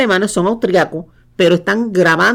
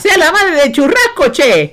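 put-on playful Argentine accent, higher, ending in a laugh; hammed-up Rioplatense joke with a chuckle; 7 semitones higher than usual. (acento argentino de broma, con risa)

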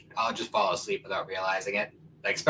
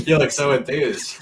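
laughing; 7 semitones higher, 9 dB louder than usual. Real, not acted. Teasing friend while laughing.

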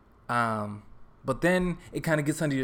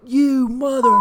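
screamed high and loud, like a raging driver; retold angry yell; 12 semitones higher, 11 dB louder, slower than usual. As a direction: bellow it at the window in road rage. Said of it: "and he's yelling".

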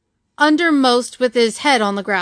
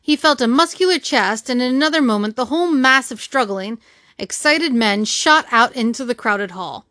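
usual speaking voice

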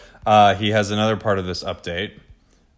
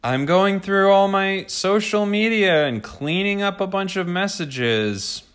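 mocking voice; 11 semitones higher, slower than usual. Mocking girlfriend's cleanup excuse.

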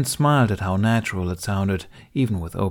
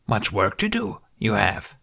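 Yoda voice. A narrator's reading. Yoda speaking in the story.

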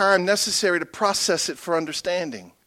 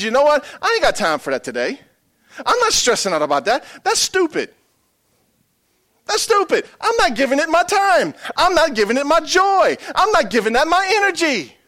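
higher, louder dismissive attitude; sassy attitude; 9 semitones higher, 3 dB louder than usual. Pretend. Congregation member dismissing stress, higher sassy attitude. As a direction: brush it off with sass. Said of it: "get a little attitude".

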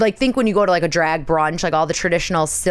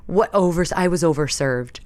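mock overserved. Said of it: "we were just laughing 'cause we were like".

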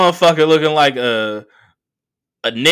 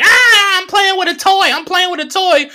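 high, loud childish glee, mocking; kid in Target; 4 dB louder than usual. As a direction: play the giddy kid with a new toy. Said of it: "like they're a fucking kid in Target".

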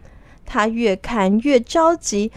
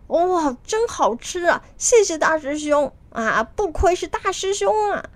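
high-pitched, delighted praise; delighted gratitude; 6 semitones higher than usual. A narrator's reading. Disciples thanking eldest brother for fruit, delighted. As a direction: gush thanks in a raised voice. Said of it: "连连的感谢孙悟空".

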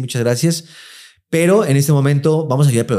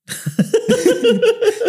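shrill laughter much higher; high shrieking laugh. Real, not acted. Risa aguda tras pregunta absurda. (carcajada chillona)

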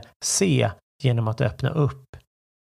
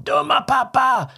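put-on much higher, childlike yell of a cross kid; angry child; 12 semitones higher, 3 dB louder than usual. Child yelling at dad, high and cross. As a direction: play the child shouting at dad. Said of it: "Om någon skriker till mig, exempelvis ett av mina barn".

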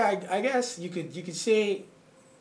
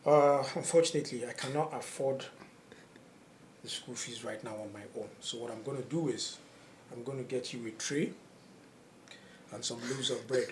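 low, hushed and deliberate, with long pauses; deadpan imitation of a grave father; solemn father; 5 semitones lower, 10 dB quieter than usual. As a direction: break it to your son quietly and seriously. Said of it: "he had this seriousness in his face".

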